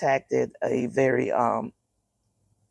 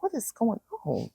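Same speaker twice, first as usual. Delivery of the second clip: high, hushed worried question; mock the worried mother; alarmed; 9 semitones higher, 5 dB quieter than usual. A mother recalling her coughing daughter, high and alarmed. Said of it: "I was like".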